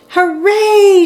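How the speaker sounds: high, loud cheer; joyful shout. A narrator's reading. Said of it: "shouted Whistler".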